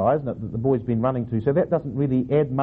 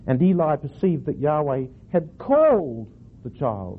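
slow read, suddenly swooping up on "called"; stress on CALLED; slower than usual. Shown as a demonstration. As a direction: overdo the emphasis on "called", then drop off. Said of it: "putting the emphasis on the wrong syllable".

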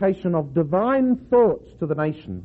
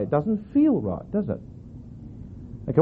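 usual speaking voice

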